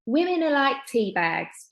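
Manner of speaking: dad lightly imitating mum's London voice; Mum's high London accent. Dad imitating his late wife's high London accent. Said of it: "putting on Mum's high-pitched London accent".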